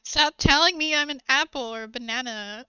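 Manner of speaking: loud, laughing-adjacent protest; mock exasperation. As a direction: protest loudly in mock outrage.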